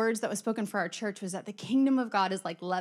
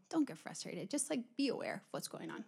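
hushed, much softer calming aside; quiet inner prompting; 10 dB quieter than usual. Retold or imitated. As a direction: murmur it like a thought to yourself. Retold calming inner prompting from God.

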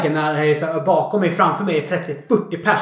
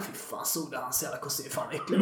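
much softer, lower mock sneer behind his back; backstabbing mutter; 5 semitones lower, 15 dB quieter than usual. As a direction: sneer it under your breath. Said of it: "går man bakom ryggen". (tyst hånfullt muttrande bakom ryggen)

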